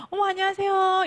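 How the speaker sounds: simper the greeting in a high voice; gushing timid greeting. Shown as a demonstration. Coach showing the wrong way to greet, high and gushy. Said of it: "이러시면 안 되는 거죠".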